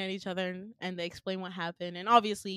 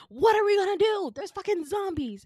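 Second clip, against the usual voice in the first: high, loud panicked yell; hammed-up alarm, voice shooting up; mock panic; 12 semitones higher, 8 dB louder than usual. Asking a friend about the zombies in high mock panic.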